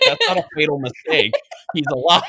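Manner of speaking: amused, emphatic disbelief; laughing protest. Retold or imitated. Viewer argues with the turkey special, laughing protest. Objecting aloud to the show's claim.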